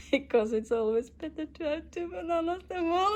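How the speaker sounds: high, loud, tearful laughing sob; cracking up and crying at once; crying through laughter. Real, not acted. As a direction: push the line out while half crying, half laughing. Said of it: "I'm like, I'm crying".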